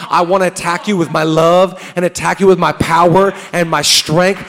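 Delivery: loud, fervent preacher voice; full-force sermon delivery; preaching as God. Acted. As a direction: thunder it out as God's promise.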